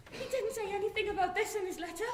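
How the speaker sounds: usual speaking voice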